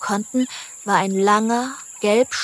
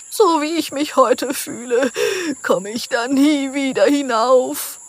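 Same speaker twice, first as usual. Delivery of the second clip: whiny; 5 semitones higher, 4 dB louder than usual. A narrator's reading. Fallen animal sure it can never climb back, whiny. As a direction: whine it, dragging out the words. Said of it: "fragte es weinerlich".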